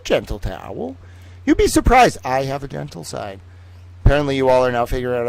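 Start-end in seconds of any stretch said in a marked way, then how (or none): 1.5-2.2 high, loud teasing claim; mock coy. Streamer teasing chat about his gentle side, high and loud. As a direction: pop it up high.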